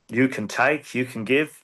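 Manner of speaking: singing-ish Aussie take on Valjean, drifting off; half-hearted Australian accent on the song line; mock Australian accent. Asked for an Aussie Valjean, he tries a mock Australian accent. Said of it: "an impression of an Australian Jean Valjean".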